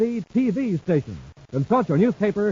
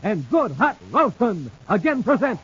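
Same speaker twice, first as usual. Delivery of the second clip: radio announcer; 5 semitones higher than usual. Acted. Announcer hyping next Saturday's episode, big announcer voice. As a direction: build up to the show's name.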